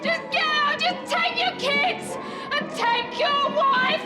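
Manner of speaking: screaming get out. Acted. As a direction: scream them out of the house. Throwing family out, screaming.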